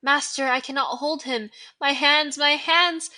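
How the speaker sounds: loud, rising cries of pain; howling in agony. Acted. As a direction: panic as the burning spreads. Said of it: "Quirrell howling in agony".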